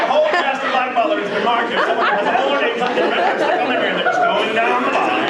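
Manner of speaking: high-pitched frantic retelling; mock panic. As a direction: escalate like a hammed-up conspiracy alarm. Retold panic on phone.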